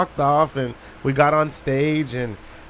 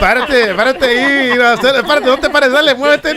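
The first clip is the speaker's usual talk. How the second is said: higher, louder hammed-up commands; mock bossy orders; 8 semitones higher, 8 dB louder than usual. Mocking the stage guy ordering him around, loud. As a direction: bark the orders like a fussy stage manager.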